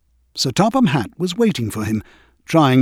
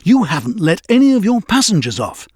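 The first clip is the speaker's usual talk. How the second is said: angrily; 6 semitones higher, 3 dB louder than usual. A narrator's reading. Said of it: "the driver said angrily".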